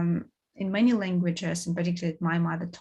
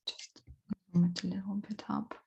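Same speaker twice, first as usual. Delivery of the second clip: quick whispered note to herself; fast private whisper while fiddling with the screen; whispered aside; 6 dB quieter than usual. Real, not acted. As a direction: mutter it under your breath, fast. Muttered aside while adjusting screen.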